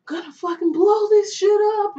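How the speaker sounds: high, loud furious yell; shouting threats from the back of a paddy wagon; raging shout. Retold or imitated. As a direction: scream it like you're losing it.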